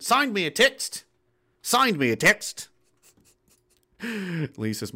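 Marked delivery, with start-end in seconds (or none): 0.0-2.7 stretched Aussie "signed me a text", rising; slow, drawn-out attempt at Australian vowels; Aussie accent. Shown as a demonstration. Practicing the Aussie lesson line, Aussie accent. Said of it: "Aussie accent lesson one".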